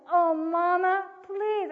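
loud, high, drawn-out plea; imitated begging. Daughter begging parent not to make her, loud wailed plea. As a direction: beg like a distraught daughter, stretching it out.

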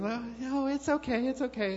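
high, sweet mock lady voice; lightly voiced polite stranger; lady's voice. Woman in line brushing off Holly's apology, high and sweet.

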